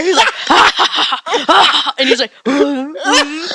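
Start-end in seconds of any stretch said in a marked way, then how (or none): 0.5-1.9 loud villainous cackle; evil laugh. Retold or imitated. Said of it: "my grandpa was evil laughing over there".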